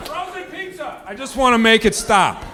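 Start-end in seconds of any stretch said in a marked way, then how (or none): 0.1-1.0 shrill alarm screech. Pretend. Smoke detector alarm shriek.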